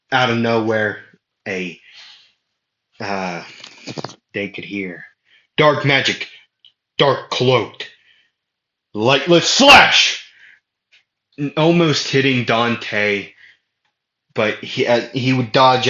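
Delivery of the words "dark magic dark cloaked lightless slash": building to a loud, slow attack call; spell shout. Acted. Attacker shouts his spell at Dante, loud and slow. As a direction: yell the spell name, rising higher.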